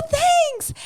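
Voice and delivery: squeaky, self-conscious thanks; flustered thanks up high; high awkward thanks. Retold or imitated. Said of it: "And I'm like".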